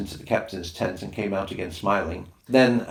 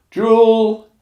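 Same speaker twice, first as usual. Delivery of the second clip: called out; 14 semitones higher, 13 dB louder than usual. Acted. Native calls native word goodnight.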